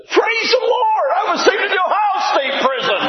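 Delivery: shouting praise, voice soaring; joyful shout. Retold or imitated. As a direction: burst out in overjoyed praise. Excited retelling of joyful meeting.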